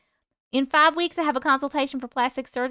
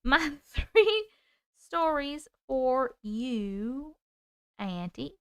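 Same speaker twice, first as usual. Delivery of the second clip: Southern accent; 4 dB quieter, much slower than usual. Real, not acted. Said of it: "I'm Southern right now, can't stop".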